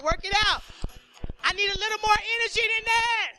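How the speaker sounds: loud, high, building crowd-pumping MC call; hype announcer. Acted. Host pumping up the audience before the comic, booming announcer.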